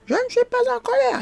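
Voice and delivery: high, fast, hammed-up yell of denial; mock shout. Angry father denies being angry, mock shouted high. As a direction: squeal the denial in a fake shout. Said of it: "il crie".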